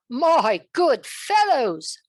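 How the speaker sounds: loud, slow proclamation to the court; king announcing to his knights; royal announcement. Acted. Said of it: "announced King Arthur to the knights".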